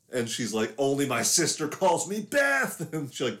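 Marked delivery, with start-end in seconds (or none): 0.8-2.9 defiant one-liner. Retold or imitated. Sister's retold one-liner before the kill.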